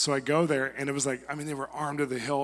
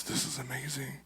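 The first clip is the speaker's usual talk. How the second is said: soft breathless whisper of wonder; awed whisper; 10 dB quieter than usual. Retold or imitated. Dictator overwhelmed by forgiveness, awed whisper. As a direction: hush it, completely overwhelmed.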